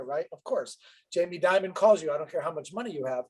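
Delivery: ordinary delivery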